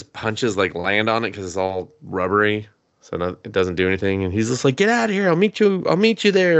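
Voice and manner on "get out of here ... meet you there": much higher, louder mock urgent call; retold urgent call. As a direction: call out as if sending them off mid-fight. Hero urges others to flee a fight.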